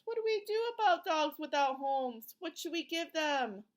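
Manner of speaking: plain reading voice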